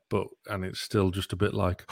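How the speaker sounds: ordinary talk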